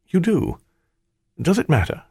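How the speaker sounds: high, caught-out questioning; nervous surprise. A narrator's reading. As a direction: ask it high, unsure of the answer. Disguise seen through, nervous reply.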